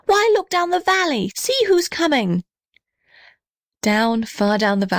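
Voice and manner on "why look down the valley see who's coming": much higher, thrilled and eager; excited cry. A narrator's reading. Little red house crying out to blue gum, thrilled. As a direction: point out the arrivals with delight. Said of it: "cried the little red house".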